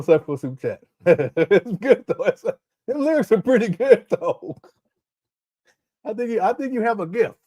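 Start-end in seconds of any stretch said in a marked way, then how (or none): 1.5-7.4 much higher, giggling compliment; laughing praise. Real, not acted. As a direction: tease him while cracking up. Praising lyrics while laughing.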